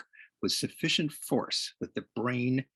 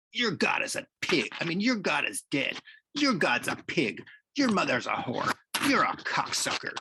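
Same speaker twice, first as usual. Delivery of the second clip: high-pitched, taunting abuse; heated schoolyard taunting; hurled insults; 6 semitones higher than usual. Acted. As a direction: fling each insult up high and hard. Catcher hurls insults back at pitcher.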